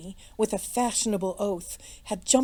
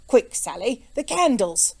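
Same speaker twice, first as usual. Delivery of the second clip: urgent shout; 3 semitones higher, 7 dB louder than usual. A narrator's reading. Flustered innkeeper calls the maid for candles, shouting. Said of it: "shouted Jellyband".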